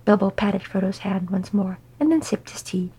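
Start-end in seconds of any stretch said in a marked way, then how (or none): none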